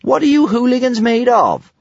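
high-pitched taunt; high, goading young girl's voice; teasing. A narrator's reading. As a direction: tease them with a mock challenge. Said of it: "she said teasingly".

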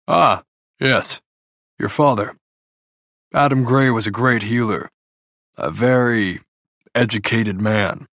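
musing father with pauses between phrases; thoughtful. A narrator's reading. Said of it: "Father says thoughtfully".

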